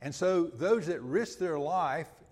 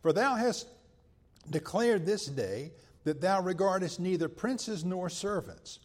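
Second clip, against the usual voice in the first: rising to a forceful rebuke, louder and higher; scripture reading, preaching force; 3 semitones higher, 5 dB louder than usual. Acted. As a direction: read Joab's rebuke with mounting force. Reads Joab's rebuke to David.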